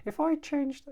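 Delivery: sudden squeaky high voice; high pitch. Shown as a demonstration. Coach demonstrating changed self-talk, in a high voice. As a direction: leap far up in pitch. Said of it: "I'm not doing that again".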